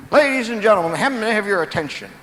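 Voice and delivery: booming, high showman's call to the crowd; a big Texan boasting over a microphone to his guests; mic announcement. Retold or imitated. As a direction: belt it out like a host at a party.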